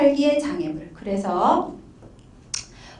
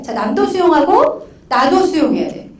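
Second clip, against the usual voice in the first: usual speaking voice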